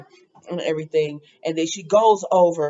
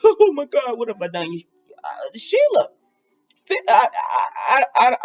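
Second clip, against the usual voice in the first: mock breakdown; 3 semitones higher than usual. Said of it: "she's sitting there breaking down".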